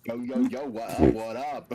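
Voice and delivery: loud, hammed-up thug hype; mock thug greeting. Friend ribbing Doc's thug act, loud mock-thug voice. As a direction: bellow a joke street greeting.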